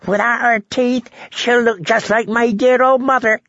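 very high, gleeful jester voice; high-pitched, mocking glee; cackling fool. A narrator's reading. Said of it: "he cackled".

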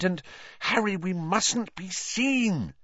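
high, drawn-out plea; high-pitched worried warning; urgent warning. Acted. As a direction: cut in anxiously to stop someone. Hermione urgently cutting in on Harry.

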